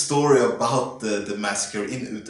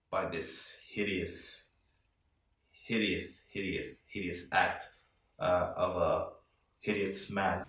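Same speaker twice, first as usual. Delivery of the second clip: hushed and grave; 5 semitones lower, 10 dB quieter than usual. Real, not acted. Reviewer recalls the massacre to viewers, hushed and grave. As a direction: let the horror weigh each word down.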